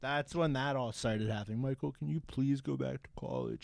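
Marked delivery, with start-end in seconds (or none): 1.6-3.6 softer mock-tearful pleading; verge of tears. Aunt begging her nephew about college, softly near tears. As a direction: imitate an aunt quietly close to crying. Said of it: "acting like they're on the verge of tears".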